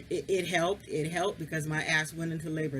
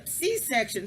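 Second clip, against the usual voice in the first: ordinary delivery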